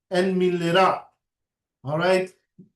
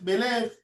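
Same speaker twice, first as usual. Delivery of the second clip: higher "מלך" stressed on the last syllable, me-LECH; French-style final stress; 4 semitones higher, 3 dB quieter, faster than usual. Shown as a demonstration. Teacher repeating the French version, "מלך" with final stress. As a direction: push the stress to the end of "מלך". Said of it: "they always put the accent at the end".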